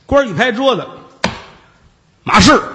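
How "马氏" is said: stern call. Acted. Official slams the table, calls her.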